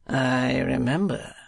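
slow, drawn-out mincing recollection; mincing dandy voice; affected gentleman. A narrator's reading. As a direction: stretch it out like a fop.

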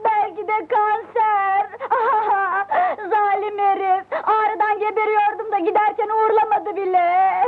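tearful wailing. Acted. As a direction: wail it melodramatically. Melodramatic stomach-ache wailing. (ağlamaklı sızlanma)

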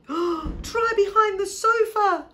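high, loud, stretched-out urgent call; shout. A narrator's reading. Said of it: "shouted Posy".

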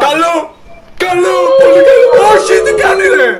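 very high, loud cheering; excited shrieking. Real, not acted. Gamer reacting to a friend's move, excited shrieking.

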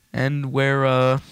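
ordinary delivery

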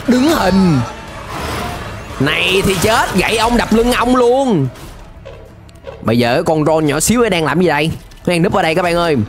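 normal talking voice

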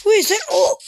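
sudden high startled cry; surprised shout; startled yelp. Real, not acted. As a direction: jump at the surprise. Sudden shock at the golden man.

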